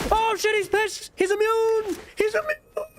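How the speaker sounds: high frantic screaming in terror; panicked shriek. Real, not acted. Gamer fleeing an angry boss, panicked high shriek. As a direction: shriek it like he's right behind you.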